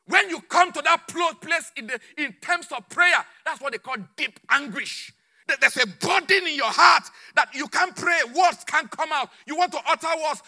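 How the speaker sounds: high, forceful pulpit delivery; impassioned preaching. Real, not acted. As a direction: press the point with mounting force.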